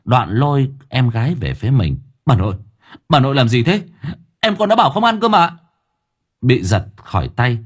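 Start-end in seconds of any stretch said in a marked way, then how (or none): 2.2-5.6 raised, urgent objection; indignant protest. A narrator's reading. Brother defending his little sister, indignant. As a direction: protest in a higher, hurried voice.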